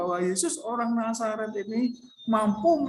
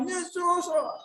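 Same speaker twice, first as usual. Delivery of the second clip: higher pleading call, over and over; repeated cry; 5 semitones higher than usual. Acted. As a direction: beg again and again. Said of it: "Diulang-ulang terus".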